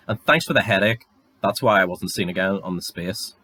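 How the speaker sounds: normal talking voice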